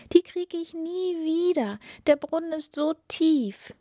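high-pitched, dismayed princess voice; wailing princess. A narrator's reading. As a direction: lament it high, as a distraught princess. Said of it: "rief die Prinzessin".

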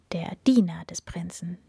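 usual speaking voice

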